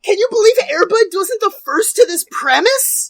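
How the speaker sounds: high, loud, hammed-up incredulity; mock disbelief. Host joins the bit about Air Bud, loud and high. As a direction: shriek it in fake shock.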